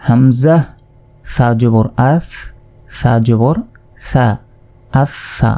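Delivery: drawn-out drill ending on a held doubled স in আচ্ছা; doubled letter recitation. Shown as a demonstration. Quran teacher drilling doubled letters for students, slow and held. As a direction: spell সা জবর slowly, then join it as আচ্ছা with the স held double. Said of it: "দুইবার উচ্চারিত হবে".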